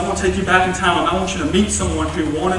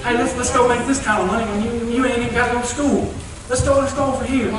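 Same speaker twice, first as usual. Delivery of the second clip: higher, coaxing hustler voice; a slick friend luring you off track, lightly done; lightly voiced tempter; 5 semitones higher than usual. A smooth talker tempting students toward a shortcut, higher and coaxing.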